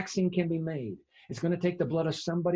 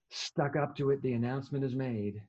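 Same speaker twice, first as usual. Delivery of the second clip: normal talking voice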